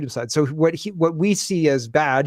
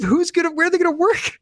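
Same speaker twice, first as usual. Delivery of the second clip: much higher, put-on exasperated voice; incredulous mock; 13 semitones higher than usual. Host scoffs at the columnist in a high mocking voice. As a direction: fire the questions as if to someone who can't see it.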